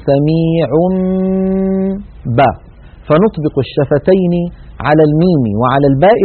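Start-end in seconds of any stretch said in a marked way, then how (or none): 0.1-2.5 سميع با with a long nasal m before ba; iqlab with ghunna. Shown as a demonstration. Teacher demonstrates lips closed on m and ba. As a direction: hum the m then close on ba. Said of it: "نقول".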